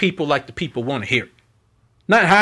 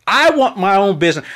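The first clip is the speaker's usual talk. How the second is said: ordinary delivery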